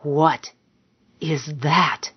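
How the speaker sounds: halting hiss, a pause after the first word. A narrator's reading. Man staring at something across the room, hissing. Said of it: "he hissed".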